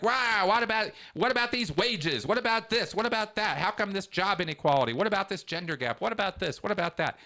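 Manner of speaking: high, heated mock outrage at experts; riled-up indignation. Venting riled up at finance experts, high indignant questions. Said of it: "And sometimes I'm really pissed, I'm really riled up".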